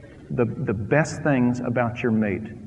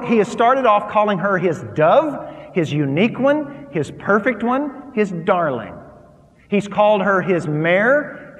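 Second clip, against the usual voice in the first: ordinary talk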